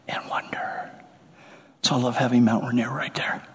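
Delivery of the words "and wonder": whisper. Real, not acted. Awe at soaring eagles.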